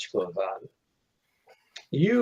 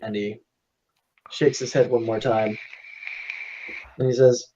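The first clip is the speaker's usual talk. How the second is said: usual speaking voice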